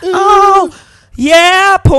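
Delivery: high, loud crooning ad-lib; hammy jingle voice. Acted. Host tacking a crooned tag onto the jingle. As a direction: croon it like a lounge singer.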